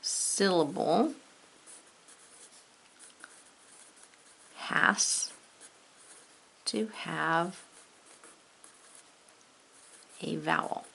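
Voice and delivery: slow and soft, "Syllable has to have a vowel" spoken while writing it out; saying it while writing. Shown as a demonstration. Teacher writing the rule on the board, slow and soft. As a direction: murmur each word as you write. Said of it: "spell it right there".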